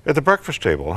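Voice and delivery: ordinary talk, nothing marked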